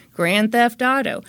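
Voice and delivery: ordinary talk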